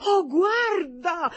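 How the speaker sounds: high, loud, drawn-out plea; begging. A narrator's reading. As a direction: beg desperately, stretching it out high and loud. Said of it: "lo supplicò".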